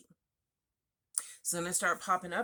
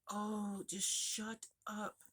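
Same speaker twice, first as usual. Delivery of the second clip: hungover grumble; 3 semitones higher, 8 dB quieter, much slower than usual. Retold or imitated. Hungover friend yelling at her.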